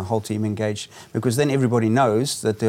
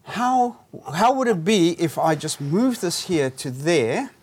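gentle suggestion; 6 semitones higher than usual. Shown as a demonstration. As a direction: coax the client gently. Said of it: "and then you'd say".

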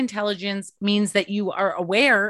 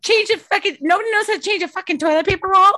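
higher, louder, fast hammed-up rant; mock yell; 11 semitones higher, 6 dB louder, faster than usual. A mother yelling at her family over the toilet paper roll, hammed-up and fast. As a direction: shriek it like a fed-up mom.

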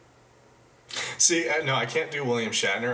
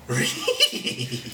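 Scooby impression. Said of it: "I could do Scooby".

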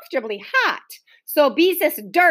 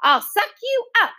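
high, playful threat; shouted; 11 semitones higher than usual. A narrator's reading. Boy charging the children with the vacuum cleaner. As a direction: menace the others in fun. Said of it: "he shouted".

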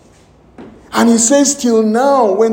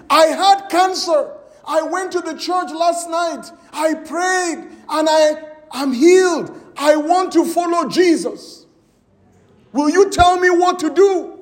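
excited testimony; 8 semitones higher than usual. Retold or imitated. A healed woman telling the preacher her news, excitedly shouting. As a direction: burst out the good news.

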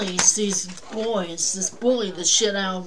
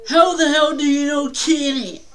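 louder hostile bark from the father; angry dad at the door; 5 semitones higher, 5 dB louder than usual. Retold or imitated. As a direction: snap at the stranger like a hostile dad.

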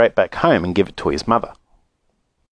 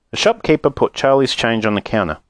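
ordinary delivery, nothing marked

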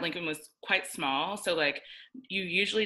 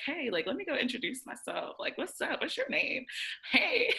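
bubbly hello rising much higher; eager greeting; 9 semitones higher than usual. Retold or imitated. Introducing herself to a classmate, eager and bubbly. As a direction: bounce up to a stranger.

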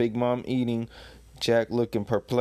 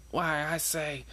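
higher, indignant character voice; hammed-up indignant protest; mock upset; 6 semitones higher, 4 dB quieter than usual. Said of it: "He's upset about that".

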